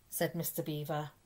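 plain reading voice

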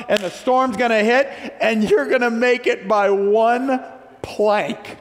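high, emphatic preacher's voice; full-force preaching. Real, not acted. Preacher warning the congregation of coming storms, forceful and high. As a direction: preach it loud and high, driving each phrase.

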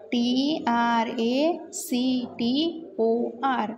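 very slow, letter-by-letter spelling; slow classroom spelling with gaps. Shown as a demonstration. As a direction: draw out each letter for the class. Teacher spells word for class.

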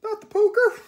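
very high, loud squealing plea; panicked whine. Acted. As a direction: wail it like a terrified monster. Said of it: "whined the boogeyman".

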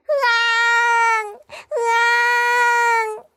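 high, long, held mock wail; baby crying. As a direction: keep bawling like a baby. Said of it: "계속 울었어요". (높고 길게 우는 아기 흉내)

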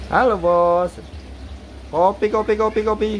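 high, held chanting cry; street seller's call. Shown as a demonstration. Owner demonstrates calls for the bird, high chant. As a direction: pitch it up like a hawker.

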